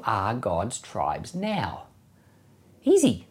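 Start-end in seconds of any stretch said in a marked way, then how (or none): none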